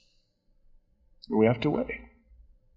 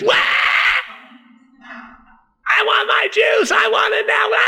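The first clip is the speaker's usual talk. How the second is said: put-on wailing toddler, much higher, with a shout; whining toddler tantrum. Pastor imitating a toddler demanding juice, shrill wailing tantrum. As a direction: howl it like a two-year-old denied juice. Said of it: "Usually it's".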